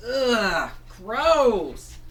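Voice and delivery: high, loud ugh of disgust. Acted. Fango kissed by the monster, disgusted ugh. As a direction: recoil from the kiss.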